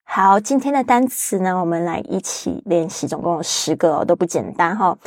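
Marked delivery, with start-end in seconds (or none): none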